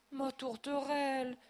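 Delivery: slow, high lament; moaning. A narrator's reading. Director bending over his wife, moaning. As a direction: moan over her. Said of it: "gémit le directeur".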